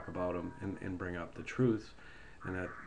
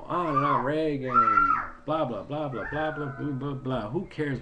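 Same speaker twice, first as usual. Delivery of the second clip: exasperated rant; 4 semitones higher, 6 dB louder than usual. Real, not acted.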